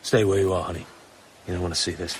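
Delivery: ordinary delivery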